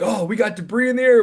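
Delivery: excited storm chaser yell; breathless shouting over wind. Retold or imitated. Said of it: "He's like".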